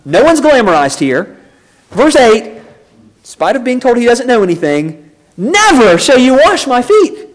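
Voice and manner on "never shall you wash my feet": exclaimed protest. Retold or imitated. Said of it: "is what he exclaims to Jesus".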